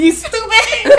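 high, laughing Liberian parent scold; West African accent. Retold or imitated. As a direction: mimic a Liberian parent, cracking up. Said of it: "Liberians be quick to say".

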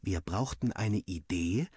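usual speaking voice

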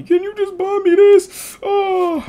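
put-on very high, slow, squeaky girl voice; mock begging sister. Little sister begging her brother to buy weed, high squeaky whine.

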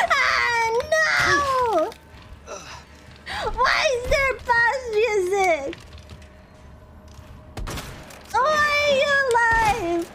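high, slow, whining panic; panicked. Real, not acted. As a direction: plead with the game in disbelief. Boss appears, panicked gamer.

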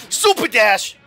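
loud attack call; calling out a move. Real, not acted. Player calling his attack in a fight, loud shout. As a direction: shout the move's name.

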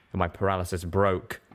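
usual speaking voice

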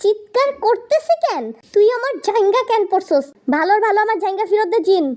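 high, indignant yelling tone; angry confrontation. Acted. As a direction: demand it back in high-pitched outrage. Quarrel over stolen underwear.

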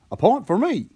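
put-on high-pitched boy's cheer. A narrator's reading. Boy scores in the hoop, high triumphant voice. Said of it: "said the boy".

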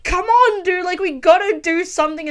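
imitating Diego Luna's character shaking his friend; a mock urgent plea to act now; urgent rebel pleading. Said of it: "he's just like".

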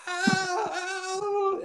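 high, sustained mock scream; long high wail imitating a man screaming; imitated scream. Preacher imitating a man being healed, high drawn-out scream. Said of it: "he started screaming. I mean, literally screaming".

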